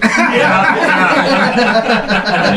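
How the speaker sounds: high, laughed-out agreement; laughing. Real, not acted. Friend agreeing about the scare story, laughing. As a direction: agree while really cracking up.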